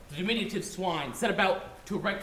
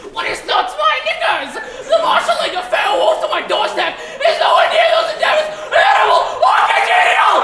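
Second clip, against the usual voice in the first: shrieking high-pitched squeal, much louder; dismayed squeal; 16 dB louder than usual. Acted. Pig two squeals at his brother in shrill dismay. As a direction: scream it like a panicked pig. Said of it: "causing pig two to cry out in dismay".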